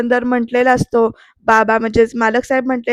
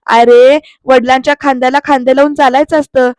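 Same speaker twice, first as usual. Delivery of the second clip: louder, hard-hitting rebuke; angry lecture; 5 dB louder than usual. Retold or imitated. Aarti rebuking Pruthvi about helping his father, loud. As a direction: drive the line at him.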